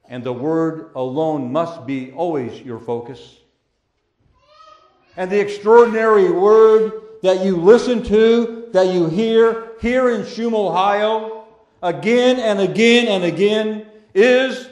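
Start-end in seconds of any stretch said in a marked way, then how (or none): 5.2-14.7 rising to a loud, high preaching climax. Real, not acted. Pastor lifting his voice to the congregation, loud. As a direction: build the voice up over the congregation.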